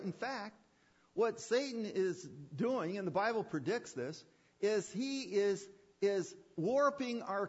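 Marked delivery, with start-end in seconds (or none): none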